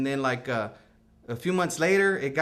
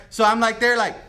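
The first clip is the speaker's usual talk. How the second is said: usual speaking voice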